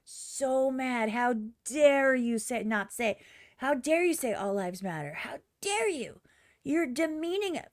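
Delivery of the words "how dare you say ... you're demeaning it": mock outrage. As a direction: parody an offended activist, dragging out how dare you. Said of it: "These songs made people so mad".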